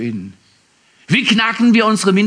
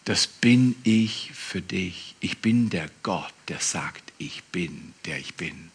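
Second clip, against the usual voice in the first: sinking to a soft, hushed whisper; soft whispered divine voice; hushed voice of God; 12 semitones lower, 9 dB quieter, slower than usual. Acted. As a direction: let God's words fall almost silent. God's voice in sermon, whispered.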